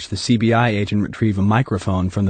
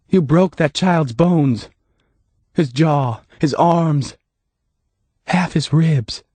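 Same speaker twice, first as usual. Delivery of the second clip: sad, no blame; 7 semitones higher, slower than usual. A narrator's reading. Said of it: "no recrimination in her voice, only sadness".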